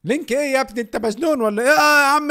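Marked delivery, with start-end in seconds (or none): 1.7-2.3 surprised outburst. Real, not acted. Confused by chatbot.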